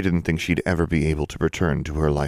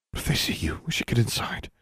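hushed frightened whisper; fearful whisper; 6 semitones higher, 5 dB quieter than usual. A narrator's reading. Claudia urging Alexandra indoors, frightened whisper. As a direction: whisper it, afraid of being seen. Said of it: "eyes suddenly filled with fear".

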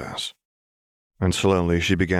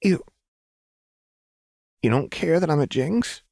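put-on high, wondering girl voice; Powder, hopeful; 10 semitones higher than usual. A narrator's reading. Powder asking Silco, high and hopeful. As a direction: ask it with hesitant hope. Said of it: "Powder asked".

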